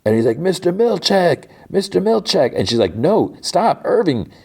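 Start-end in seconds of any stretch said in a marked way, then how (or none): 0.4-2.5 retold cry for attention; calling out. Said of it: "hear Irving calling for Mister Milchek". 2.9-4.3 high-pitched imitation of pleading; acted-out distress; alarmed call. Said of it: "hear Helly craw-, call out".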